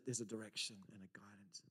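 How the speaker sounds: unvoiced awed whisper; soft reverent whisper. Real, not acted. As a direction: hush it like a secret. Intimate guidance.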